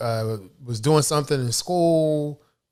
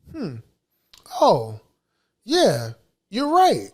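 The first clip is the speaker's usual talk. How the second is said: dawning realization; 6 semitones higher than usual. Retold or imitated. Son realizing in story.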